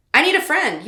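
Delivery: high, loud eager plea; eager call. Acted. Alexis calls after Saya, loud and eager. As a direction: call it out to her.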